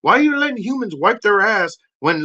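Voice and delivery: ordinary delivery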